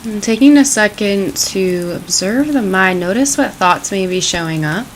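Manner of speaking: calm, unhurried meditation voice; guided meditation. Acted. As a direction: ease them gently into the practice.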